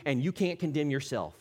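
ordinary talk, nothing marked

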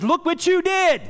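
high, loud, sneering accusation of Satan; accusing Satan. Retold or imitated. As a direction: jab the words at the sinner like the devil. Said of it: "when Satan comes up to you ... and says".

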